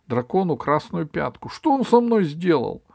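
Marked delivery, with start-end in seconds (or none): 1.5-2.8 high, loud complaint; outraged king appealing to others; indignant cry. Acted. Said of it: "закричал король". (возмущённый возглас)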